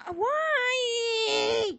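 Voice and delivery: high, loud exasperated yell; frustrated shout. Real, not acted. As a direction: shout at the game in disbelief. Frustrated outburst in maze.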